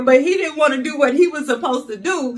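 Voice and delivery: ordinary talk, nothing marked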